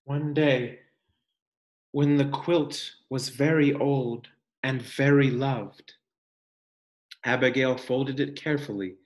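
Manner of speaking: gentle, expressive picture-book reading; reading to children. Acted. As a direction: read it like a storybook for the class.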